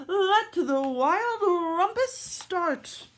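high, slow, loud royal command; a king crying out to his wild subjects; wild rumpus shout. Acted. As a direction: shout it out to start the party.

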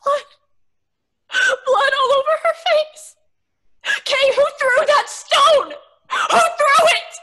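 loud, high, shaken cry; frantic horrified shout. Acted. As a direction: demand it in horror. Horror at injured friend.

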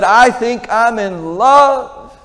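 high, slow, dreamy lovestruck voice; lovestruck son. Acted. Young man confessing to his father he's in love, dreamy and drawn out. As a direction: stretch it out like a boy head over heels.

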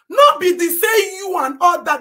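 angry retort; indignant shout. Real, not acted. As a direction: throw it back at them. Indignant rant.

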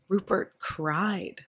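hushed, dwelt-on statement; slow emphatic beat. A narrator's reading. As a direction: let the two words sink in slowly. Narrator lingers on the surprise.

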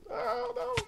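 high frantic shouting; panicked yell. Real, not acted. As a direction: shout in disbelief. Zombie breaking in.

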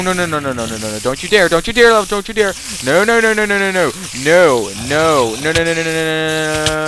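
real frantic gamer pleading. Real, not acted. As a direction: beg the mob to stop in a panic. Mob attacking, player pleading.

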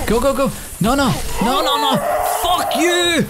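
real panic, shouting at the enemy chasing him; frantic shouting. Real, not acted. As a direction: yell it while fleeing for your life. Frantic escape from attacker.

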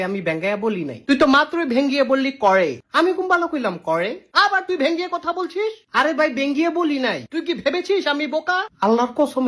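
ordinary talk

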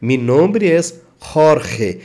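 slow, Jorge with throaty J, horhe; Spanish Jorge. Shown as a demonstration. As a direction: Jorge begins with a rough h. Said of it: "esse J seria um R".

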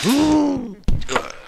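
sharp high gasp; startled gasp. Real, not acted. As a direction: gasp in shock. Gasp at game mishap.

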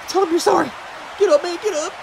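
usual speaking voice

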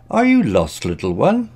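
high, sweet cooing voice. A narrator's reading.

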